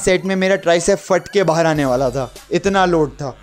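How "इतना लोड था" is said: ordinary delivery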